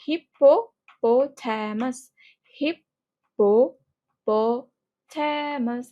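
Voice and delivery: হিপ্পোপোটামাস stretched very slowly so each sound is heard; teacher's slow demo of হিপ্পোপোটামাস; drawn-out model word. Shown as a demonstration. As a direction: drag হিপ্পোপোটামাস out for the class. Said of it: "এই যে পিটা double".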